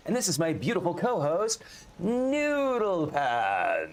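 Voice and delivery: high put-on voice, Noodle Pants stretched very slow; milk the name slowly in her voice; co-host's voice again, drawn out. Host redoing his co-host's intro in her voice, slow and drawn out. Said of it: "She says I can't do her voice properly".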